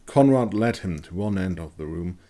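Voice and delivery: ordinary delivery, nothing marked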